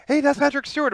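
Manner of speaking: high, loud re-enacted recognition; excited retold exclamation. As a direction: act out spotting a famous voice. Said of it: "I actually was like".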